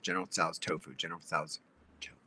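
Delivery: tongue-twister drill; fast tongue twister. Shown as a demonstration. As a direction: rattle it off under the breath. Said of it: "Say it, like, five times fast".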